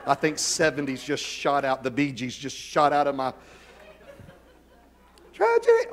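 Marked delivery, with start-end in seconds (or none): 5.3-5.9 sudden high squeak of mock despair; a jokey high-pitched wail; mock tragic squeal. Real, not acted. Pastor mocking his own dated slang with a squeak.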